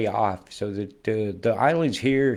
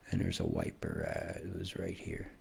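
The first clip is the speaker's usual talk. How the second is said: whisper; 10 dB quieter than usual. Real, not acted. Muttering aside.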